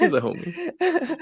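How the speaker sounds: playful high-pitched echo of his own joke; silly high repeat. Acted. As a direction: echo the line in a goofy high voice.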